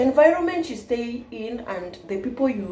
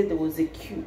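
Nigerian English; 4 semitones lower, 7 dB quieter than usual. Shown as a demonstration. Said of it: "You say".

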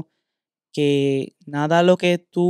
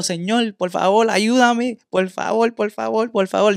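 higher, pleading cries to the Lord; retold desperate plea; 5 semitones higher than usual. Trucker retelling his panic at three in the morning, higher pleading mock cries. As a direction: beg God for help in a mild mock shout.

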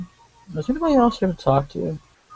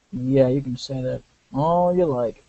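normal talking voice

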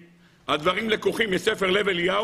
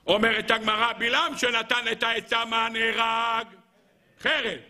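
higher, level chant with drawn-out ending; Gemara singsong; 5 semitones higher than usual. Acted. Preacher quoting the Talmud's verdict, chanted. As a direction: intone it, holding the last word long.

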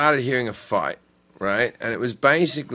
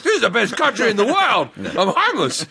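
mock proud homeless man; 9 semitones higher than usual. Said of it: "you're still like".

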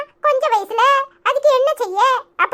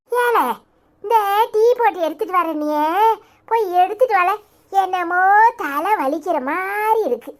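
granny dodging with a headache; lowered grandmother voice turning tired; evasive grandmother; 6 semitones lower than usual. Acted. Grandmother changes subject.

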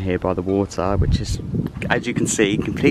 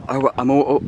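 ordinary talk

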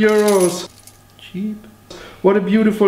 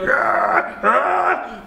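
monster groan; 8 semitones higher than usual. Acted. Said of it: "this wonderful monster going like".

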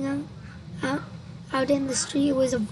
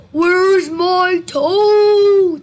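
higher, loud, drawn-out wail; eerie stretched-out creature call; groaning ghostly voice; 6 semitones higher, 15 dB louder, much slower than usual. A narrator's reading. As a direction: groan it slowly at full volume. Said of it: "the voice groaned".

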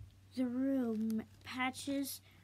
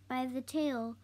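usual speaking voice

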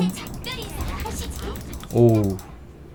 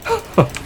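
high laugh; 4 dB quieter than usual. Real, not acted. Laughing at play.

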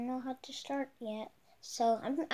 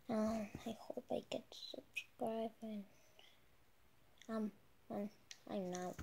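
soft whispered aside; whispering; 3 semitones lower, 12 dB quieter than usual. Real, not acted. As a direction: whisper it to yourself. Unvoiced aside.

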